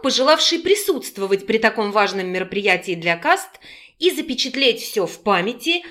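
usual speaking voice

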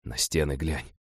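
faint dying whisper; weak whisper. A narrator's reading. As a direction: barely breathe the words. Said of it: "слабеющим голосом прошептал".

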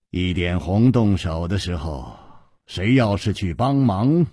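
low, mildly rueful hero; 苦笑着说. A narrator's reading. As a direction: say it low, with a touch of wry resignation. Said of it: "楚留香苦笑着说". (低沉，略带苦笑)

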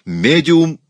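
high, loud alarm; frightened shout. A narrator's reading. Raoul reminds madame of the medium, frightened shout. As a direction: shout it as an urgent warning. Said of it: "испуганно закричал Рауль".

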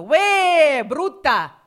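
drawn-out high, very loud yell; yelled catcall. Retold or imitated. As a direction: holler it like a man shouting from a passing car. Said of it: "un tipo mi ha urlato".

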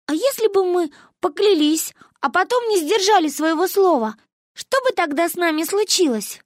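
put-on high, dreamy childish question; innocent girl. A narrator's reading. Manya asking grandmother a sly question. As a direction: ask it wide-eyed like a little girl.

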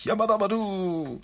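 high whooping Yabadabadu; battle cry. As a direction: burst in with a gleeful war whoop. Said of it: "Und Donatello schreit".

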